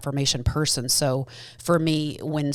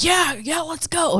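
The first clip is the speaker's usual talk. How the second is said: high, bouncy mock-eager voice, much higher; Brian's eagerness; 13 semitones higher, slower than usual. Husband Brian cheerfully pushing to try mushrooms, high and eager. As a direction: play the eager husband, pitched way up. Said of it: "Brian's like".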